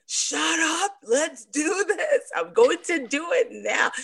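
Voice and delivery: high, laughing mock bark; hammed-up tough coach, laughing; playful bossy coach. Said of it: "And I'm like".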